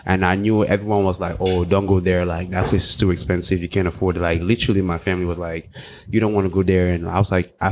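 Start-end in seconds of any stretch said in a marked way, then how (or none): none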